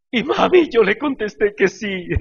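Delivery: high, loud tearful wail; mock crying. Pastor retells confessing to his wife, hammed-up high crying. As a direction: blurt it out in exaggerated tears. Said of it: "Y estaba yo llorando".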